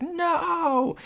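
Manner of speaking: high-pitched panicked cry; mock panicked no. Corey sees the teacher outside the door, a high panicked no.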